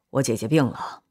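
low, softened admission; subdued confession. A narrator's reading. Philip tells Gino about his sister, low and subdued. Philip breaks grim news.

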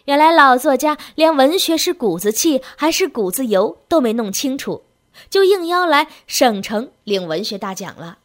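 loud, incredulous exclamation; appalled narrator raising the voice; astonished outburst. A narrator's reading. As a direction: blurt it out loudly in disbelief. Narrator's astonished outburst after gasping.

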